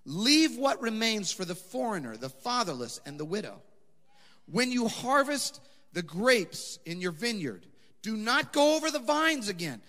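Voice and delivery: high, loud scripture proclamation; loud, high preached reading; preaching at full force. Acted. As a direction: preach the law with conviction.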